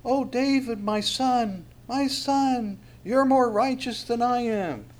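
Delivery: hammed-up crying out, high and drawn out; Saul. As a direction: wail it in a put-on high voice. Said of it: "Saul cries out".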